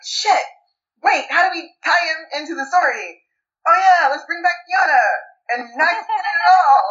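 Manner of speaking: mock brainstorming. Writers working him into the story, mock scrambling.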